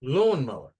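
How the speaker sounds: Lawnmower with a loud LAWN; Lawnmower, first syllable strongest; stress on first syllable. Shown as a demonstration. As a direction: lean hard on LAWN in Lawnmower. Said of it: "First syllables".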